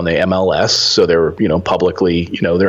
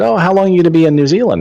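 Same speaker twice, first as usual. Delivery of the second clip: chatty question; 10 semitones higher, 4 dB louder, faster than usual. Retold or imitated. Retelling a plane neighbour's question.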